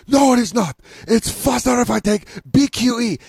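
high-pitched, indignant mock yell; imitated yelling driver. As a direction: dig in stubbornly, voice shooting up high. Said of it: "and the guy's yelling at me".

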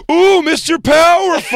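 high, slow sing-song mockery of a powerful man; mocking high voice. Friend mocking Louis's apology in a high sing-song voice. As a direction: drag it out high and teasing. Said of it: "He goes".

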